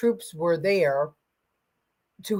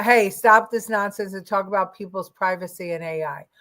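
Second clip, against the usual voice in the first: ordinary talk, nothing marked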